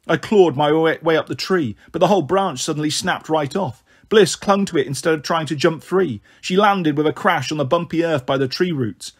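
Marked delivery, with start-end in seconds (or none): none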